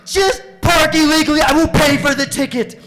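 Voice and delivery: high, frantic full-volume scream. Retold or imitated. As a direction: scream desperately at the circling driver. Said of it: "So I scream at her".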